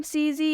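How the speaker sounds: "सीजी" with the silent final E wrongly voiced as a long ee; final E sounded. Shown as a demonstration. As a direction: say "सीजी" in two syllables, ending in ee. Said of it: "या फिर सीजे नहीं पढ़ेंगे".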